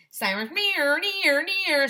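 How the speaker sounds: high, loud siren wail on near; siren imitation. Narrator imitating screaming fire sirens. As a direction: sing it like a wailing siren.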